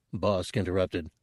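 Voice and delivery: usual speaking voice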